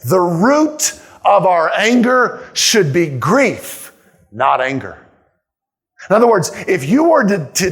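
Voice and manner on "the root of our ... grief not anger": every word slowed and weighed; slow emphatic repeat. Shown as a demonstration. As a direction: say it again slowly so it sinks in. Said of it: "Let me say that again".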